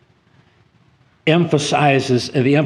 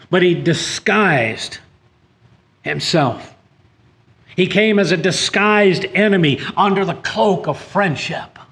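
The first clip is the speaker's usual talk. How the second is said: preaching at full force; 6 semitones higher, 4 dB louder than usual. Acted. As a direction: drive the warning home from the pulpit. Preacher builds to condemnation.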